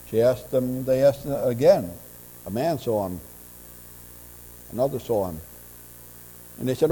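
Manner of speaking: usual speaking voice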